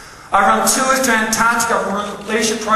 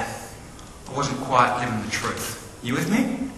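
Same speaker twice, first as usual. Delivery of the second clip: normal talking voice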